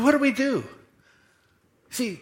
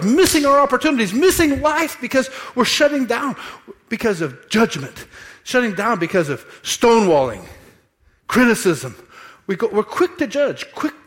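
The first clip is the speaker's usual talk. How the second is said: loud, much higher preaching climb; fiery preaching; 7 semitones higher, 7 dB louder than usual. Acted. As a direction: drive it home to the congregation. Preaching at full force.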